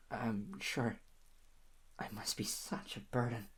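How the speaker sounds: murmuring sadly to her partner; self-pitying murmur. Acted. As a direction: sink into quiet self-pity.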